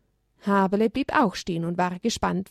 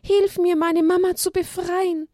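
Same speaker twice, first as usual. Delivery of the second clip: much higher little lamb begging for help; pleading lamb call; 11 semitones higher than usual. Acted. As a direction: beg the angel to free mama. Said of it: "rief Haberle laut". (flehender Ruf des Lämmchens, viel höher)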